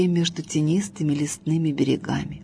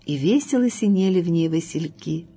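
plain reading voice

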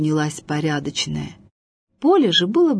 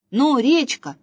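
high, louder bossy shout; calling out; 6 semitones higher, 5 dB louder than usual. A narrator's reading. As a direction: hurry the river along. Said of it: "покрикивал Василёк-болтунок".

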